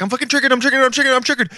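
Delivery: high, loud mock meltdown; mock triggered rant. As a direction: rant it like a kid losing it. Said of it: "you're just like".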